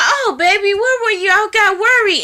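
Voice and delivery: high, pleading voice with a crying edge; worried girlfriend. Retold or imitated. Wife greeting her drunk man, worried high plea. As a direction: play her as anxious and clingy, voice lifted high. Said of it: "And she's like".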